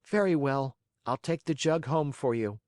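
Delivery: put-on high puppet-boy voice, mildly firm; firm Pinocchio. A narrator's reading. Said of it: "said firmly".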